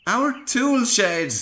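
groaning. A narrator's reading. Workman seeing his crashed shed, dismayed groan. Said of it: "groaned the workman".

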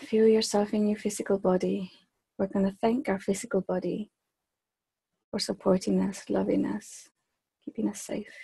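measured meditation voice with long, unhurried pauses; guided meditation. Acted. Leader guiding a group to extend their awareness, guided meditation. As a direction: guide them gently inward, phrase by phrase, softening as it goes.

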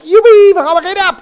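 high, loud joyful Joepie; excited cheer. Acted. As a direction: shout it with childlike glee. Puppet cheers show starts. (uitgelaten gejuich)

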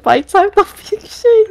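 high teasing call. Retold or imitated. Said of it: "I was like".

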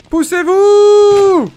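drawn-out shout; shouted order. Pretend. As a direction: bellow to clear the way. Firefighter clearing the way.

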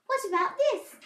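high, loud squirrel voice; excited cry. A narrator's reading. Squirrel tries a trick for Babbitty, excited cry. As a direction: cry it out eagerly. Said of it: "Squirrel cried".

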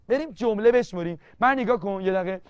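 normal talking voice

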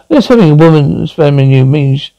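usual speaking voice

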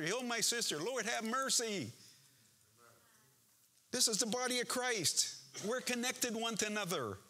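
impassioned preaching. Real, not acted. Preaches with rising force.

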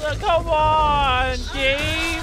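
slow, high, loud outraged protest; frustrated yell. Real, not acted. Player whose key press failed, loud slow outrage. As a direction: yell at the game in disbelief.

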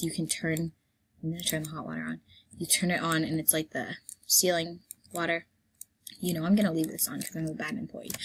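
usual speaking voice

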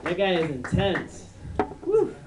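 normal talking voice

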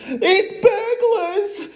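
very high, slow, strangled alarm; hammed-up frightened hiss; panicked hiss. A narrator's reading. Mother tells family of burglars, hissing in panic. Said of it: "hissed the mother".

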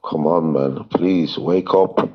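ordinary delivery

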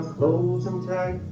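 ordinary delivery

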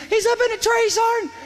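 high, loud urgent yelling; retold yelling. Soldier yelling to his sergeant about a shooter, high urgent shout. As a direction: yell it high over gunfire. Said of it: "Floyd starts yelling".

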